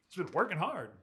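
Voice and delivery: ordinary delivery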